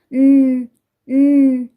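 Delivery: a humming nnn with no vowel; उम as a pure hum, no vowel; bare phonic n sound. Shown as a demonstration. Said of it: "इस तरीके से".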